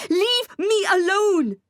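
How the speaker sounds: high, slow, screamed plea. A narrator's reading. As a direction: scream it out at him, drawn long. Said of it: "I screamed".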